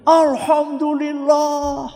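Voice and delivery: seruan syukur yang panjang dan bernada tinggi; suami lega. Acted. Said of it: "kata siapa? Bapak". (seruan lega seorang bapak, suaranya melengking panjang: seruan syukur bapak yang lega dan dipanjangkan)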